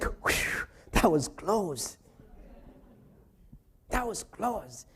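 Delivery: relieved whisper. Retold or imitated. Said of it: "And Jacob was like".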